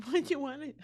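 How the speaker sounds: laughs through the quote; can't stop giggling while quoting; laughing. Real, not acted. Retelling husband's advice, laughing.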